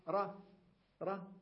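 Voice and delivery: را said high with an open a vowel; vowelled R. Shown as a demonstration. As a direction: say را with the R moving. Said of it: "الفظ الراء متحركة". (نطق را بالراء متحركة بصوت مرتفع)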